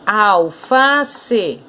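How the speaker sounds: "alface" split into three separate syllables. Shown as a demonstration. Teacher separating "alface" for pupils, syllable by syllable. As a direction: space out each syllable of "alface". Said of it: "você vai separar a sílaba aqui".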